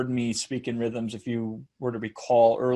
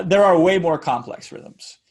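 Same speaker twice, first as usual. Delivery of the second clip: ordinary delivery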